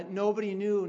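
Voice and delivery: normal talking voice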